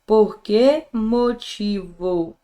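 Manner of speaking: drawn-out "Por que motivo", word by word; slow demo. Shown as a demonstration. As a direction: spell out "Por que motivo" slowly, like testing it on the board. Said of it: "vamos tentar aqui, ó".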